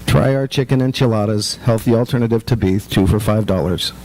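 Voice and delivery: bored Costco sampler calling out, low and monotone; monotone sample worker. Retold or imitated. Store worker hawking samples to shoppers, low drone. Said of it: "in their monotone voices".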